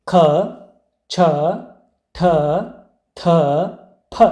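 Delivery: ಖ, ಛ, ಠ, ಥ, ಫ said with extra breath; letter by letter, ಖ, ಛ, ಠ, ಥ, ಫ aspirated, with pauses between; aspirated consonants. Shown as a demonstration. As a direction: push a puff of air after each of ಖ, ಛ, ಠ, ಥ, ಫ. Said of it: "ಹೆಚ್ಚು ಉಸಿರಿನಿಂದ".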